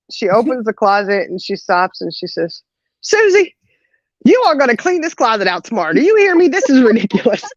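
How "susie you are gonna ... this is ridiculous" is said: high, exasperated mock telling-off; hammed-up motherly rant, pitched way up; imitated scolding mom. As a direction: scold a child like an irritated mother. Acts mom scolding her in story.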